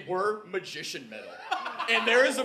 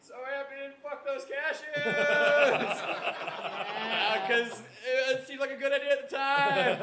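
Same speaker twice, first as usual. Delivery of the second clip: high dreamy inner voice; 6 semitones higher, slower than usual. Acted. JD's inner monologue while midair.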